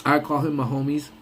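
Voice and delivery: ordinary talk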